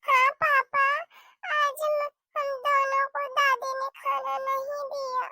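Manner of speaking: put-on hungry child whining to papa; complaining little child. Child tells father.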